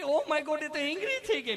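a startled yelp after running from the dog; scared tourist. Retold or imitated. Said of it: "મને કે". (ગભરાયેલો મોટો ઊંચો અવાજ)

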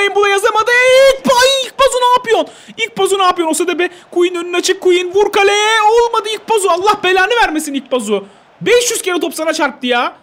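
high, loud angry outburst at the missed chance; frustrated yelling. Real, not acted. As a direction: yell at the useless forward. Player misses chances, furious.

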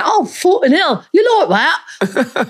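put-on a light Cockney accent, laughing through it. Student teasing the professor in a light laughing Cockney accent. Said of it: "I would do a lot of Cockney accent".

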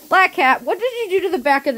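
high, loud call to the cat. Real, not acted. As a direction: call out to catch her attention. Scolding cat about chewed chair.

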